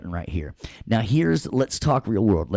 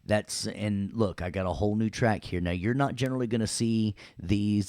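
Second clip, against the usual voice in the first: ordinary delivery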